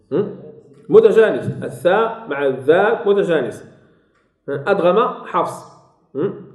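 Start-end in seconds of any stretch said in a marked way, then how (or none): none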